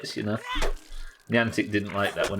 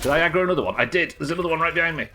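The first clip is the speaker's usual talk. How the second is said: being chased; 8 semitones higher, 5 dB louder, faster than usual. Real, not acted. Monster chasing him in game.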